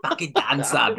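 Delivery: a touch of mock Essex swagger; Essex accent. Podcaster imitating a teammate coming in all Essex, light Essex accent. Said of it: "Callum Watson comes in all Essex".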